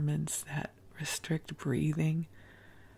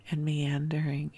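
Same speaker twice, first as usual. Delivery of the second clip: plain reading voice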